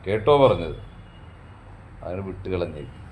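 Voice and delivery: usual speaking voice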